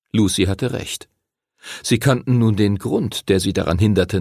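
ordinary delivery

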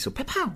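high comic pow pow blasts; cartoon pow noises; pow sound effect. Shown as a demonstration. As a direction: fire off pow pow like a cartoon shield. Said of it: "das ist bester Soundeffekt".

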